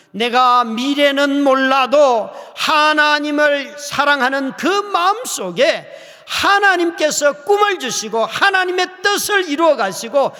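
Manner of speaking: loud fervent sermon climax; preaching at full force. Real, not acted. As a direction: build to a climax.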